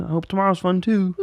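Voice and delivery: high, thin frog puppet voice; sing-song Muppet frog; Kermit the Frog voice. Retold or imitated. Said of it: "Was that a Kermit the Frog voice?".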